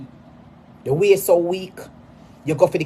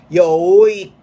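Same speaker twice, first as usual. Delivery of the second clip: much louder, higher jeer; loud taunt; 4 semitones higher, 10 dB louder than usual. Real, not acted. [name] calling him weak, loud taunt. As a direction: bark it right in his face.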